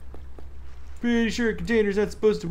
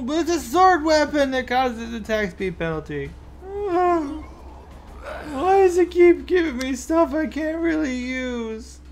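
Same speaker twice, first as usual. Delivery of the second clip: high, exasperated whine; whiny complaint; 10 semitones higher than usual. Real, not acted. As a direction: complain to the game. Complaining about loot.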